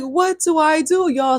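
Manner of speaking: reliving the panic, pleading; a child close to tears, pleading; panicked. Retold or imitated. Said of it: "So I'm mid panic".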